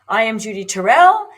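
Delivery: usual speaking voice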